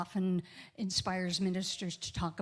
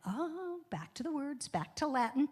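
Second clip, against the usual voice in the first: realizing; 6 semitones higher than usual. Retold or imitated. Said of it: "and I went".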